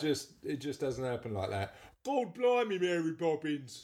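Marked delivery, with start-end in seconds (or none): 2.1-3.8 loud, hammed-up bad Cockney; exaggerated fake Cockney exclamation, slowing at the end; dodgy Cockney accent. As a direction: do a terrible film Cockney, pitched up. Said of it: "his dodgy Cockney accent".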